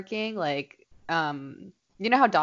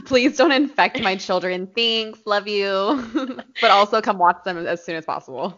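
mock boss voice; 3 semitones higher, 5 dB louder than usual. Mocking the boss's message.

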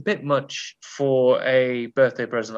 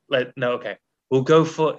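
ordinary talk, nothing marked

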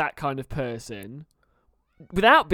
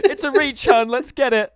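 laughing; 8 semitones higher, 5 dB louder than usual. Real, not acted. Laughing through the words.

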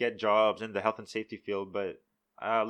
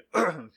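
dry cough; 6 semitones higher, 6 dB louder than usual. Real, not acted. Coughing fit mid-sentence.

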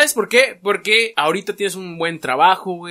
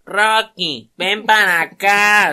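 lazy mother calling; 3 semitones higher, 5 dB louder, much slower than usual. Retold or imitated. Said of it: "La señora así".

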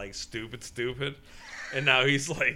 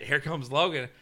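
normal talking voice

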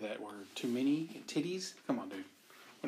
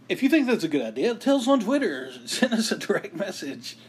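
higher, louder podcast-ad announcer voice; mock promo read; 5 semitones higher, 7 dB louder than usual. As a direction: pitch it like a radio plug, with a laugh.

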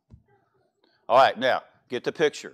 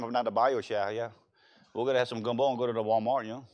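softer, lightly put-on bayou Cajun; mama's Cajun accent; 3 semitones lower, 6 dB quieter, faster than usual. Preacher showing the congregation how mama talked, mock Cajun accent. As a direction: imitate a Cajun mother's lilt, lightly. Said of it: "My mama taught like this all the time".

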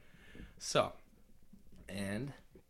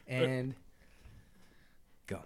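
usual speaking voice

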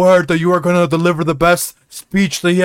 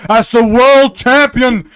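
usual speaking voice

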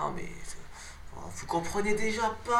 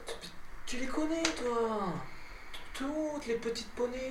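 high, soft, slow cooing; 5 semitones higher, 11 dB quieter, slower than usual. Acted. Adventurer asking about little ponies, high soft cooing. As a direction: coo it softly up high.

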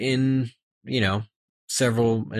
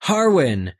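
higher, louder cry of recognition; calling out a name; 7 semitones higher, 4 dB louder than usual. Acted. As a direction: call out to him. Girl calls out name.

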